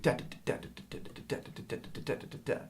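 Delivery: hushed, voiceless rhythmic "da" syllables; imitated drum pattern. Shown as a demonstration. Drum teacher sounding out the triplet accents, hushed imitated drum pattern. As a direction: tap out the triplets softly with the tongue. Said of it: "So you'd be like".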